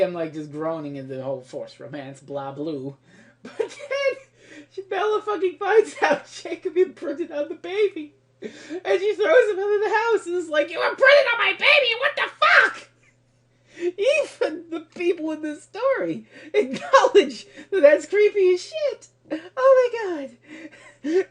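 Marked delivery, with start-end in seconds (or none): 3.4-10.7 high-pitched laugh-crying rant; cracking up while ranting, voice way up; laughing hysterically. Real, not acted. Hysterical rant about imprinting. 10.7-12.9 mock outraged shout. Acting Bella throwing Jacob out. 13.8-21.3 high-pitched laugh-crying rant; cracking up while ranting, voice way up; laughing hysterically. Real, not acted. Hysterical rant about imprinting.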